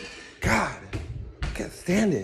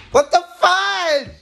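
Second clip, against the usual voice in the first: loud, high, stretched-out exclamation; startled yell; 9 semitones higher, 6 dB louder, slower than usual. Real, not acted. Spooked vlogger in a possibly haunted house, startled yell. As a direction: yell it in shock, dragging the last word.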